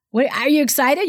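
normal talking voice

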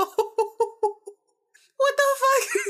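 slow, very high, laughing what the fuck; laughing disbelief. Real, not acted. Reacting to the caller's verdict, high laughing disbelief. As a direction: squeal it out through laughter.

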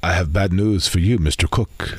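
a hint of the low genie. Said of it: "made up the genie voice".

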